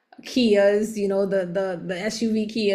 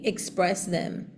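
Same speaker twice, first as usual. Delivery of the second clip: ordinary talk, nothing marked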